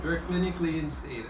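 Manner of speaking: high, loud exclamation; exclaiming. Real, not acted. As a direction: call it out in disbelief. Exclaiming about eating it.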